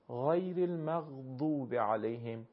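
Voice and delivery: slow «غیر المغضوب علیهم» with a full emphatic ض; emphatic dad. Shown as a demonstration. Teacher shows what listeners should hear, ض in «المغضوب» as emphatic dal. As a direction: make ض in «المغضوب» sound like a heavy, thickened d.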